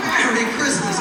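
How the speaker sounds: high, loud, exuberant holiday greeting; jolly Christmas well-wisher. Retold or imitated. Man handing candy canes to waiting family, loud high cheer. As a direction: gush it out over the noise. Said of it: "this guy's looking at my dad going".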